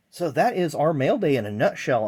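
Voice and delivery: breathless, loud wrap-up; talking while winded; out of breath. Real, not acted. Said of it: "I'm almost out of breath here".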